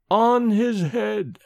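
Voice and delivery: slow, high, hollow echo; drawn-out eerie voice of an echoing hall. A narrator's reading. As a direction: stretch each word out like a ghostly echo. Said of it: "echoed the dim hall".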